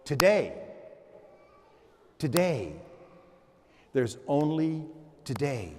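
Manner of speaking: monk calming himself with a mantra. Retold or imitated. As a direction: settle yourself down with it. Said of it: "they would stop and they go".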